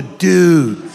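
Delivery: exaggerated California surfer dude; laid-back mock California accent. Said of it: "Or California".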